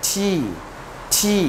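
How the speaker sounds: টি with a light, gentle t; easy, unforced টি; soft, tender T. Shown as a demonstration. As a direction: caress the t of টি, hold the ee. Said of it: "আদর করে বল".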